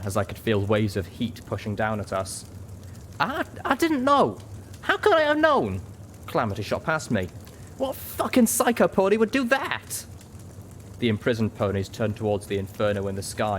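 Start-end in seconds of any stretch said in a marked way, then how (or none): 3.2-5.8 high, stammering, distressed excuse; panicked protest. A narrator's reading. Panicked excuse as blimp burns. 7.8-10.0 high-pitched, incredulous outburst; outraged disbelief. A narrator's reading. Pegasus outraged while fleeing fire.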